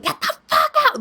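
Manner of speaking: shrill high yell at the rodent. Retold or imitated. A crazy lady yelling at a rodent, loud shrieking. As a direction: scream it like a crazy lady chasing a rat off. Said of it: "I sound like a crazy lady yelling".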